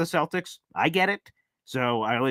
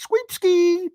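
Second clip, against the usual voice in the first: sweepski squeaked up very high; gleeful squeal; 5 dB louder than usual. Real, not acted. Host gloating over a sweep, squealed up high. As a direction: squeal it out in triumph.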